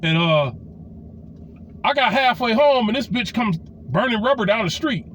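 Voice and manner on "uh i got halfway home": ordinary talk, nothing marked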